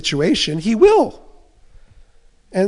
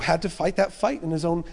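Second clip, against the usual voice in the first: normal talking voice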